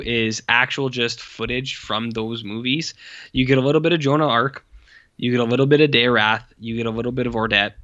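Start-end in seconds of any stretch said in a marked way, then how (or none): none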